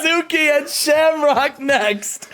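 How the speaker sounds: high, slow, breaking into laughter; can barely get the names out for laughing; laughing announcement. Real, not acted. Teasing the co-host with the next match, laughing hard.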